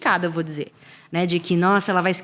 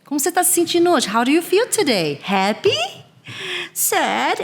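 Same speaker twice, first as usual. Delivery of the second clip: put-on high, sing-song questions to little kids; teacher asking children; 9 semitones higher than usual. Teacher asking small children how they feel, sing-song. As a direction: coax the kids into answering, lilting. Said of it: "A entonação que eu utilizo".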